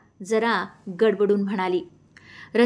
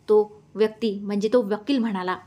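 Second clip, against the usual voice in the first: ordinary delivery, nothing marked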